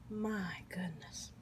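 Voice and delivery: slow breathy whispered awe. Real, not acted. Cook overwhelmed by the flavor, slow whisper. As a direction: breathe it out slowly, overwhelmed by taste. Savoring bite.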